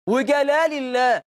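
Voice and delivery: slow mock country oath; villagers' oath. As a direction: imitate villagers swearing by God, stretched out. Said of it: "الجماعة في الفلاحين يقول لك إيه".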